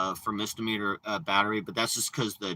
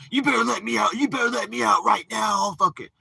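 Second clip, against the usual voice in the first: much higher, yelling mock panic; mocking drama; 13 semitones higher, 5 dB louder than usual. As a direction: ham up his shouted demand.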